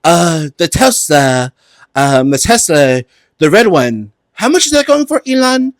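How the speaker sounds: hesitant fumbling car-salesman impression; Trump voice. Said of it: "And he's like".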